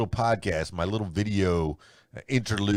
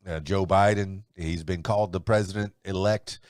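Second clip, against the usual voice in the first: ordinary delivery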